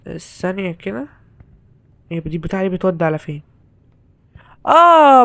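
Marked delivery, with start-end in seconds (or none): 4.7-5.2 startled yelp. Real, not acted. Sudden shock during gameplay.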